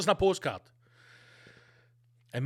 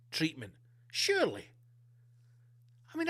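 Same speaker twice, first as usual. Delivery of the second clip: normal talking voice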